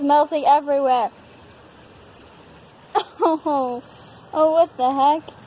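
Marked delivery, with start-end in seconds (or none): none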